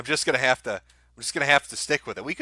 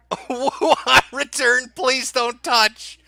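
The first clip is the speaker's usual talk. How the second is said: laughing mock sign reading; 9 semitones higher, 6 dB louder, much slower than usual. Real, not acted. Mocking a sign on camera.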